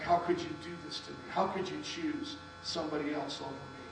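retold hurt, held in and soft; inward anger. Jilted boyfriend reproaching his girlfriend, quietly angry. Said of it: "I was so angry on the inside".

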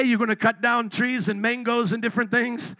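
ordinary delivery

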